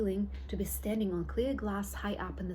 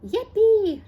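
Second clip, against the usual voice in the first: a much higher, much louder whoop; joyful shout; 12 semitones higher, 14 dB louder than usual. Acted. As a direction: whoop with glee as the lift shoots up. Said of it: "shouted Grandpa Joe".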